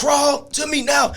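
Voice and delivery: slow, high-pitched order; commanding cop. Retold or imitated. Cop ordering the man to crawl, slow high command. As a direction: draw out the command in a strained high voice.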